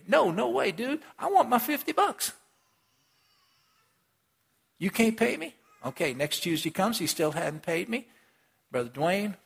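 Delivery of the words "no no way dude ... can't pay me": high scornful refusal; high sneering no way, dude; dismissive refusal. Acted. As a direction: brush him off mockingly, pitched up. Servant refuses his debtor.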